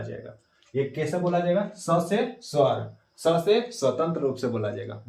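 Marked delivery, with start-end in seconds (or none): 1.7-5.0 स से स्वर stretched out word by word; drawn-out memory rhyme; very slow mnemonic. Shown as a demonstration. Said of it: "बोलो, याद हुआ?". (याद कराने वाला धीमा सुर)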